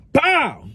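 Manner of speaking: high punchy bow; mock pistol smack. Narrator making the sound of the pistol hit. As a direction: smack it out like a gun butt hit.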